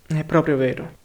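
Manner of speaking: ordinary delivery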